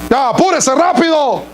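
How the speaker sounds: high-pitched, rough hurry-up order; brusque scolding of a child; harsh parent. Acted. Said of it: "le hablan tosco a los, a sus hijos".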